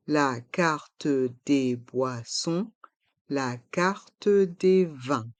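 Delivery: "la carte des boissons, la carte des vins" syllable by syllable; slow model pronunciation. Shown as a demonstration. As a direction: slowly separate every syllable, sounding the e of "carte". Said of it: "Prononçons".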